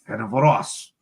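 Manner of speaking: Ferencvaros said with a light French accent; mock French. Shown as a demonstration. Host trying the team name in a mock French accent. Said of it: "say it in, like, a French".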